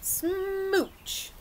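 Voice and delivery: long high drawn-out smooch; playful smooch. Acted. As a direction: stretch the smooch up high for the kids. Mimicking the fish's kiss.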